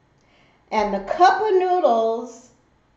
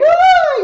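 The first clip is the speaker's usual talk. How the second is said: loud, very high mock scream; piercingly high, full-volume imitation scream; imitated child's scream; 12 dB louder than usual. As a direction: shriek like a panicking son. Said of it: "my son's screaming like".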